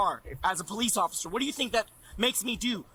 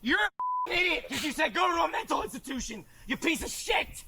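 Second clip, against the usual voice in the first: raised, high-pitched angry yelling; real angry outburst; 6 semitones higher than usual. Real, not acted. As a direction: lash out at them, voice pitched up. Angry outburst at people laughing.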